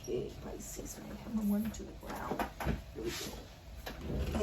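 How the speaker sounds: soft unvoiced whisper to the cards. Real, not acted. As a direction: hush it, barely any voice.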